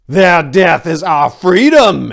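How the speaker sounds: rally the army with it; battle cry. Acted. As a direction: warlord shouting to his rebel army. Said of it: "Aristomenes called out".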